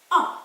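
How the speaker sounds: "ho" sounds just like "O"; silent h. Shown as a demonstration. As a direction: say "ho" as a plain O, the H silent. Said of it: "never pronounce it".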